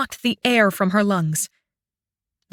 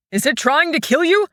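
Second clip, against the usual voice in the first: high, alarmed call; alarmed cry; 6 semitones higher than usual. Acted. Shang calls to trapped Fen, alarmed. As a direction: shout out in alarm. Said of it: "Shang cried in alarm".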